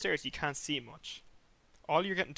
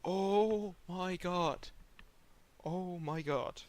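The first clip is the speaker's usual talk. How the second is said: reliving pain; 4 semitones higher, much slower than usual. Retold or imitated. Relives the second whip stroke.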